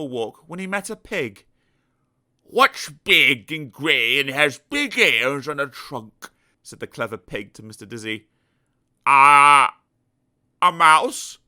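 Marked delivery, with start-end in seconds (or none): 2.4-6.3 slow, loud, smug pig voice; a know-it-all pig teasing; clever pig. Acted. Smug pig testing Mister Dizzy, slow and loud. 9.1-11.4 a muddled man guessing; unsure Mister Dizzy. Acted. Mister Dizzy guessing wrong to the pig, puzzled.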